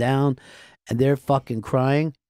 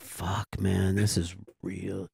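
soft, slow, stunned under the breath; hushed dread; 9 semitones lower, 11 dB quieter, slower than usual. Retold or imitated. Producer recalling the moment he feared she was dead, hushed and slow. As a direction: barely voice it, slowly, as panic sinks in. Realizing the woman won't wake.

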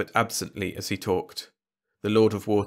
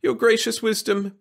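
high, pleading address; begging; 14 semitones higher, 3 dB louder than usual. A narrator's reading. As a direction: beg the king for mercy. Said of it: "begged Wirt".

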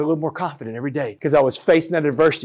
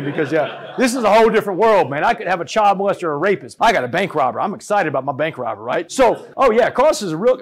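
normal talking voice

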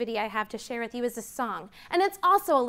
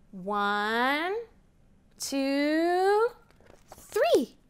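building countdown; 3 semitones higher, much slower than usual. Acted. Teacher counting to children, building to an excited three.

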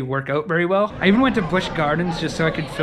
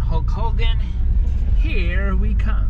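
hyped announcement; 6 semitones higher, 4 dB louder, slower than usual. Real, not acted. Arriving in Clearwater, hyped.

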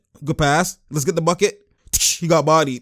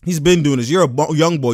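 usual speaking voice

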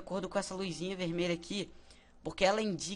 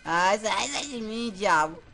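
loud, frantic yelling at a monster; panicked shout; 5 semitones higher, 8 dB louder, slower than usual. Real, not acted. Monster attacking the player.

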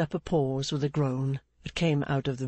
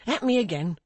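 higher-pitched outcry; remorseful cry; 7 semitones higher than usual. A narrator's reading. Pancks urging Clennam to attack him, remorseful cry. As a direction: cry out, inviting more blame. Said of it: "cried Pancks, grinding his teeth in remorse".

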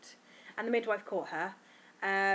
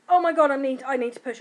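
loud urgent "I need to push"; urgent cry; 5 semitones higher, 12 dB louder than usual. Retold or imitated. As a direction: call it out as the urge hits. Retold urge to push in labour.

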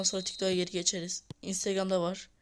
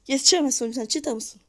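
louder, higher shout at the player; exasperated yell; 4 semitones higher, 8 dB louder than usual. Real, not acted. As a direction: bark it at the defender. Yelling at defender.

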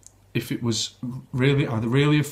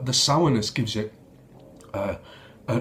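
normal talking voice

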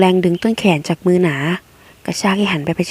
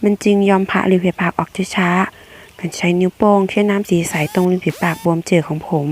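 ordinary delivery

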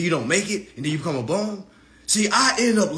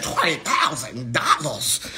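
put-on old head disbelief; 3 semitones lower, slower than usual. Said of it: "the old head in me came out".

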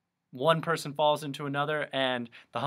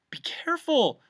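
high, louder alarmed cry; shouted warning; 5 dB louder than usual. A narrator's reading. Milo warning as the cane swings, shouted. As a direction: call out in sudden alarm. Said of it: "shouted Milo".

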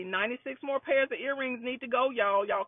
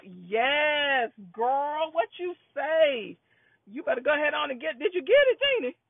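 excited shout-out, climbing higher and louder; excited cheering on; 4 semitones higher, 5 dB louder than usual. Real, not acted. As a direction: whoop it up for a customer. Cheering on a buying viewer.

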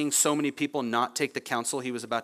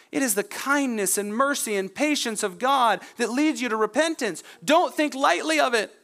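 raised, insistent pulpit voice; impassioned preaching; 8 semitones higher than usual. Real, not acted. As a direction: preach it with urgency, pitched up. Preaches with rising force.

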